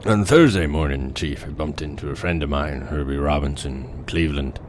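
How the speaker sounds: a grim shark hunter remembering the Indianapolis; Robert Shaw as Quint. Acted. Reciting the Jaws monologue as a haunted old sailor. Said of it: "Tell me if I captured Robert Shaw".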